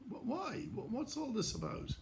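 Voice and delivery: high, anxious rising questions; a young man suddenly panicking; retold panic. Retold panic on learning news.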